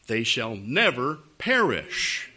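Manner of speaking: slow, weighty scripture quote; slow, deliberate verse reading. Acted. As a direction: spell out the verse slowly for a doubter. Rereads verse to stubborn listener.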